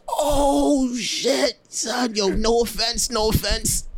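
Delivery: high, gleeful exclamation, half sung; delighted high voice, last line sung slowly; excited amused. Retold or imitated. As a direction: gush about the accent. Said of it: "I said".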